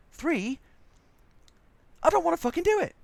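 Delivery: high, loud petulant complaint; high-pitched indignant rant; whiny angry protest. Acted. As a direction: whine it like a furious overworked employee. Complaining about the mission.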